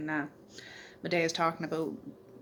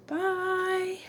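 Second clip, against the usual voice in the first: high sing-song bye; 13 semitones higher than usual. Real, not acted. Sign-off to viewers.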